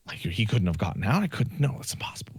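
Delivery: dropping to a near whisper; whispered disbelief over the missing cat; hushed disbelief. Retold or imitated. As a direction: breathe it out, stunned it's impossible. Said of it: "whispered disbelief".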